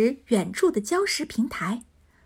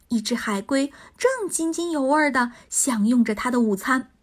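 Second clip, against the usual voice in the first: usual speaking voice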